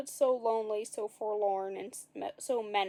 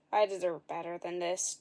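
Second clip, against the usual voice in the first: whined; 5 semitones lower than usual. A narrator's reading. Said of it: "Jack whined".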